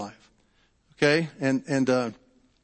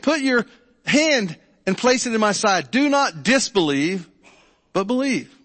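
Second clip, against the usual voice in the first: raised, louder Bible reading voice; scripture reading; 6 semitones higher, 3 dB louder than usual. Acted. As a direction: read the passage out with weight to the congregation. Pastor reads the Bible passage aloud.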